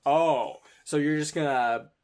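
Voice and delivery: loud, theatrical teasing; hammed-up mock outrage. Upperclassman mocking the player's little magic hand, hammed-up. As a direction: ham it up for the onlookers. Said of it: "he's kind of hamming it up".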